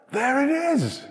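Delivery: high and bright exclamation; joyful high there it is; delighted discovery. Acted. Acting father seeing son.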